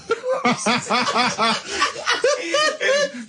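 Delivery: high, squealing burst of laughter; long high cackling laugh; helpless laughter. Real, not acted. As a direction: lose it completely at a silly pun. Cracking up at the kermitted pun.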